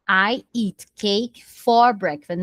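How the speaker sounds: slow, clear model sentence; slow classroom example. Shown as a demonstration. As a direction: say it slowly for learners. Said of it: "Por exemplo".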